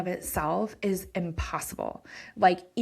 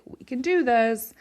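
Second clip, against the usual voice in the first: self pep talk; 4 semitones higher, 5 dB louder than usual. Retold or imitated. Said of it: "closed my eyes and was like".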